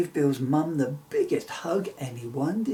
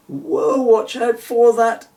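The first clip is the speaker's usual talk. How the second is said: alarmed shout; 10 semitones higher, 13 dB louder, slower than usual. Acted. Warning shout mid-flight.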